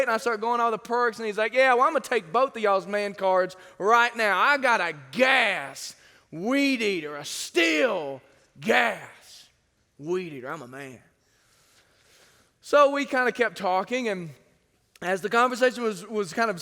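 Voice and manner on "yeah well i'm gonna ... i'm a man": a dad bragging about his gas weed eater, low and macho; macho boast. Retold or imitated. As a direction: brag like a big manly man. Macho guy quoted again, deep voice.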